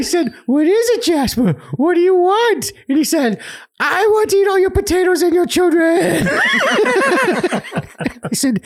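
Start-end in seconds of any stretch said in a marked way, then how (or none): 0.5-2.7 mock questioning. Asking ghost in story. 3.8-6.3 high, shouted, laughing ghost voice; hammed-up ghost threat. Ghost Jasper demands potatoes and children, high laughing shout.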